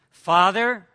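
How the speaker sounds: loud, formal "Father"; loud formal address. Acted. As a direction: call him Father loudly and formally. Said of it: "that very formal word".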